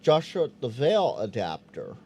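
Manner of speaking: usual speaking voice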